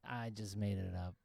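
flat dismissive. Retold or imitated. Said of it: "and then they go".

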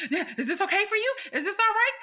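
high-pitched, needling fake question; mock taunting. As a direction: imitate a customer goading the worker. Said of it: "she was taunting me".

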